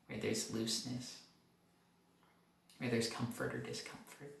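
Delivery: soft, calm meditation guide; guided meditation. Acted. Doctor leading viewers up through the body, calm and soft. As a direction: lead the body scan gently, letting pauses breathe.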